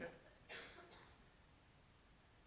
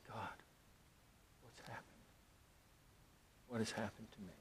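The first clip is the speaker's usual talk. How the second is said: much softer, stunned plea to God; hushed prayer; 28 dB quieter, faster than usual. Retold or imitated. Husband in shock after the attack, hushed prayer to God. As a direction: barely voice it, in shock.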